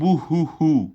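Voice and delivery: hammed-up sobbing sounds from the queen; storybook queen pretending to sob; mock crying boo hoo. A narrator's reading. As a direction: fake a childish boo-hoo cry. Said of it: "ऐसे बोल के रानी रोने लगी".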